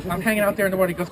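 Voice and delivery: ordinary delivery, nothing marked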